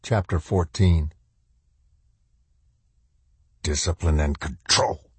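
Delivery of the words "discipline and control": stern shadow soldier, lightly voiced; a touch of the drill master. A narrator's reading. Shadow soldier lecturing, mildly stern. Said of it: "in a voice like the crack of a belt".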